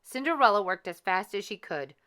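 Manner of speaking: ordinary delivery, nothing marked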